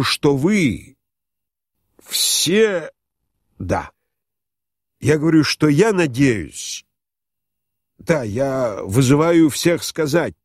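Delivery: halting, wounded appeal rising in pitch; near tears. A narrator's reading. Humiliated general appealing to the guests, almost crying. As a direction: falter between phrases, build to a drawn-out plea. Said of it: "Иван Ильич чуть не плакал".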